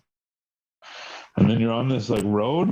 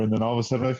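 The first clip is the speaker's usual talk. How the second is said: ordinary talk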